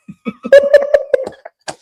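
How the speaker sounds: loud, high real laughter; burst of laughter. Real, not acted. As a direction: crack up loudly. थाने वाली बात पर ठहाका. (ज़ोर का ऊँचा ठहाका)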